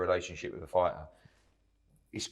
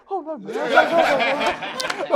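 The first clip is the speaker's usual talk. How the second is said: very high-pitched, panicked squeal of no's; voice shooting up octaves; 8 dB louder, slower than usual. Retold or imitated. Promoter mimics himself in a clip refusing the boxer, voice shooting sky-high. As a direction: replay your own voice leaping into squeaky falsetto. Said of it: "my voice just went up about eight octaves".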